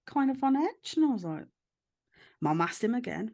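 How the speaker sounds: usual speaking voice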